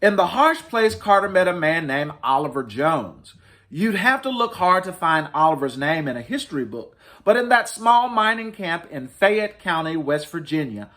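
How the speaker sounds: lightly lifted storybook read-aloud; reading to children. Acted. Librarian reading to families, mild storytelling voice. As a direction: tell it gently like a picture book. Reads picture book aloud.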